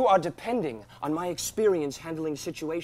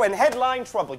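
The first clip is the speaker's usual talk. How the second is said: usual speaking voice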